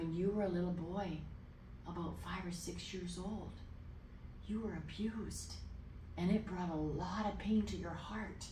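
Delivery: soft, tender, careful telling; hushed compassionate delivery; gentle revelation. Retold or imitated. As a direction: break it to him softly, pausing between phrases. Retold tender word to stranger.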